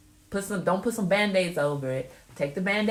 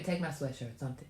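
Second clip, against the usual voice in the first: usual speaking voice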